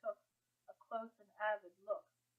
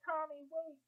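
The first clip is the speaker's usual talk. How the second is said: pleading call; 8 semitones higher, 6 dB louder than usual. A narrator's reading. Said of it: "she said".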